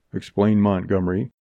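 ordinary delivery

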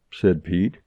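plain reading voice

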